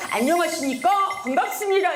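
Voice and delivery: military greeting. Shown as a demonstration. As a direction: snap out the greeting like a soldier. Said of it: "여기 군이니까요". (군인처럼 절도 있게 외치는 인사)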